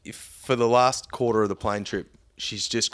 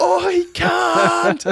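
very high, loud mock shriek; piercing imitation of a passenger's shout; retold yell; 8 dB louder than usual. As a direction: bellow after someone walking away, mimicking her. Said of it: "then she yells".

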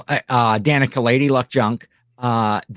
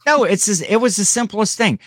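choked up, pushing through tears; emotional; 8 semitones higher than usual. Real, not acted. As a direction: voice pitched up with emotion. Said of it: "I'm so emotional".

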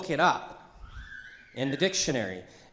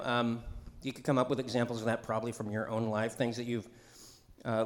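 usual speaking voice

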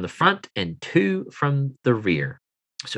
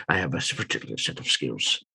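much lower and softer, quick grim growl; a hushed mock action-hero threat; movie tough-guy imitation; 9 semitones lower, 9 dB quieter, faster than usual. GM echoes the movie line.

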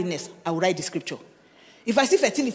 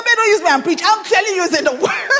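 laughing protest; 10 semitones higher, 8 dB louder than usual. Real, not acted. Laughing retort to husband's joke.

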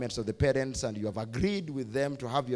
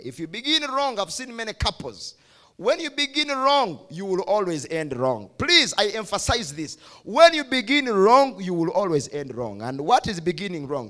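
preaching at full force; 6 semitones higher, 8 dB louder than usual. Real, not acted. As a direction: hammer the warning home to the congregation.

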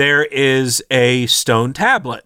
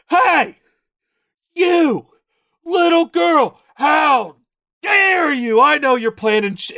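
mock yelling; 12 semitones higher, slower than usual. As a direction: yell it at the little girl, hammed-up. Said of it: "all they do is just yell at the little girl".

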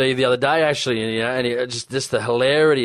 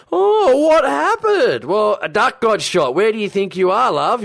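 high, drawn-out mock wail, then quicker indignation; old lady protester; 9 semitones higher, 4 dB louder, slower than usual. Old lady at the duck hunting protest, mock distress. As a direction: imitate a distressed old lady, pitched well up. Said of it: "that old lady was sitting in the back".

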